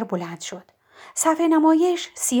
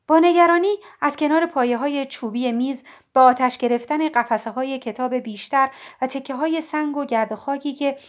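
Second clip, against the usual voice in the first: usual speaking voice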